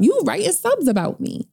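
accusing. Retold or imitated. Accusing the sister.

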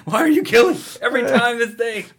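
high laughing mock voice; mock deaf guy. Joining the deaf-man bit, high giggling mock voice. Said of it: "the signing".

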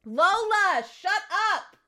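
high sharp call; high call to the barking dog to hush. Real, not acted. Calling dog to stop barking.

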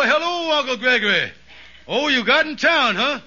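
ordinary talk

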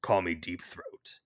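a slow, hushed informant from a parking garage; deep throat spy. Retold or imitated. Co-host imitating a spy informant, lightly voiced low and slow. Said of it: "they're like".

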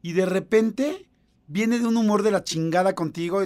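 usual speaking voice

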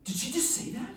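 ordinary talk, nothing marked

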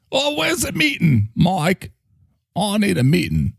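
high, light Cockney-pirate voice; a cheery Cockney plea for a meeting; mixed Cockney accent. Acted. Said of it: "Australian, Cockney, or pirate".

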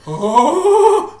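high, loud burst of laughter; shrieking laugh. Real, not acted. Cohost bursting into loud laughter at the memory. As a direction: cackle helplessly.

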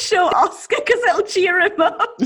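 high, laughing, almost crying; laughing joke. Real, not acted. Friend joking about the bad haircut, laughing. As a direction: squeal it through laughter.

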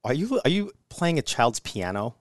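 ordinary talk

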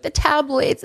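high whiny complaint; mock whining. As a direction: whine it like a spoiled victim. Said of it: "she's like".

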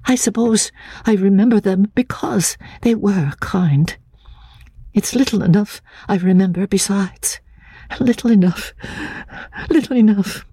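put-on high, hesitant, frightened girl's voice; a nervous fragile woman, stammering; lightly breathy, fearful young woman; timid young woman. A narrator's reading. Anne Catherick's timid high voice.

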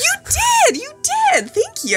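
delighted. Real, not acted. Delighted laughing reply.

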